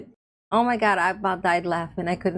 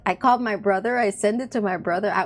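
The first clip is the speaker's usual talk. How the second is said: usual speaking voice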